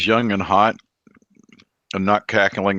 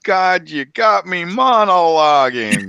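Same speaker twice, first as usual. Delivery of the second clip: higher, louder, slow hammy villain; mock villain exclamation; 7 semitones higher, 5 dB louder, slower than usual. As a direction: ham it up like a caught villain.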